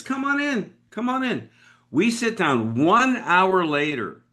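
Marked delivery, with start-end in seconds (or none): 0.0-1.5 a hint of a relaxed guy in shorts; laid-back host. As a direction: wave him in casually. George Tate voiced, laid back.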